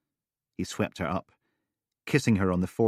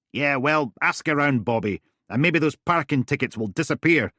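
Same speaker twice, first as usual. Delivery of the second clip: raised, louder character voice; character dialogue; 8 semitones higher, 6 dB louder than usual. Acted. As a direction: voice the phone caller pointedly.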